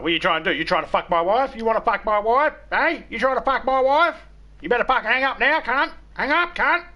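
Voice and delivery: high, loud, aggressive demands; nasty angry shout. Retold or imitated. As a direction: yell it like a threat down the phone. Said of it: "I was nasty".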